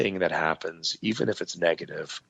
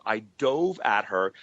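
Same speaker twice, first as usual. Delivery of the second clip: usual speaking voice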